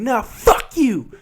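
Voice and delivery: high, drawn-out mock defiance; slow, high imitation of a defiant passenger. Passenger cursing back at the driver, high mock defiance.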